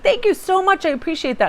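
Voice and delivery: put-on high, sweet thank-you of an old man; grateful old gentleman. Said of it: "He's like".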